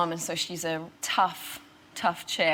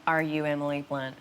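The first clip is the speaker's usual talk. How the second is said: put-on Midwest Southern accent; 4 semitones lower than usual. Local questions the actress at dinner, Southern accent. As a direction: lower imitated Southern small-town voice. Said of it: "sounds a little bit Midwest and Southern".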